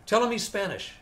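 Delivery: high and loud snap back; quick comeback. Retold or imitated. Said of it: "Brother Ray shot back right away".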